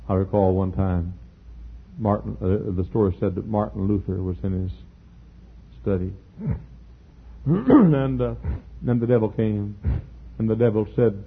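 normal talking voice

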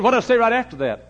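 high, loud retold demand; loud insistent question. Louder second question in retold exchange.

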